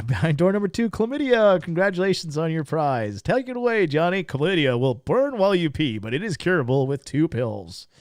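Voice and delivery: mock prize-reveal patter with swooping pitch; playful hammed-up TV host voice; game show announcer.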